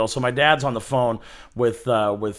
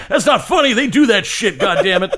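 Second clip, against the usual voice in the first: put-on high, worked-up old man voice; imitated indignant grandpa grumble; cranky grandpa impression; 7 semitones higher, 4 dB louder than usual. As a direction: protest like an aging Rodney Dangerfield. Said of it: "your grandpa's an aging Rodney Dangerfield".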